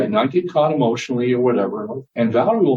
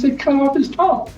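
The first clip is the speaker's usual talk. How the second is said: higher, matter-of-fact wife voice; wife's verdict. Retold or imitated. Wife tells husband the fix, higher, matter-of-fact. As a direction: state it plainly as the wife. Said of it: "She goes".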